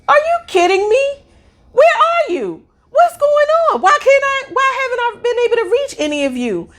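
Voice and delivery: very high-pitched incredulous scolding; squeaky-high retold outrage, speeding up at the end; exasperated outburst. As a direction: imitate an outraged mother demanding answers. Retold outburst at unreachable daughters.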